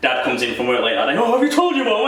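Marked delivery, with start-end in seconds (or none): 1.2-2.1 squeaky much higher teasing question; mock-high dad's question. Dad coming home late, asking his son again, voice pitched way up.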